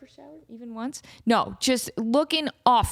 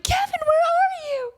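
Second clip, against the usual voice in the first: very high, drawn-out wail; re-enacted panicked call for a lost pet; desperate calling; slower than usual. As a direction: cry out slowly and desperately. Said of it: "that desperate".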